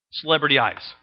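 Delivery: low, soft mutter of the made-up word; quiet aside. Real, not acted. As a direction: toss the word off under your breath. Coined word repeated as an aside.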